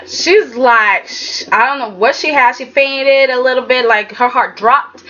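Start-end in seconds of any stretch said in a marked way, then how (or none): none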